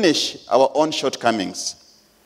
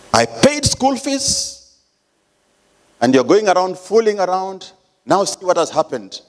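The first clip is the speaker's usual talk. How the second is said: scolding parent; 6 semitones higher than usual. Pretend. Judging parent scolds pregnant daughter.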